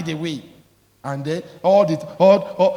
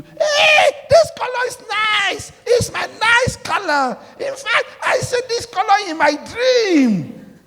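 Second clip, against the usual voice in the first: much higher, slow squealing delight; hammed-up gushing buyer; 5 dB louder, slower than usual. Preacher mimicking a buyer gushing over the color, much higher. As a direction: ham up a giddy buyer.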